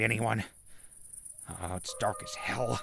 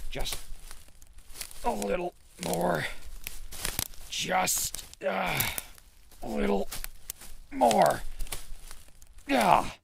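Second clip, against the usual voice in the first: straining effort; 9 semitones higher, slower than usual. Acted. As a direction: push hard through the brush. Straining through thick bushes.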